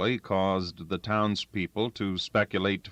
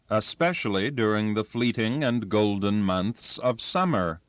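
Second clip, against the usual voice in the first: ordinary delivery, nothing marked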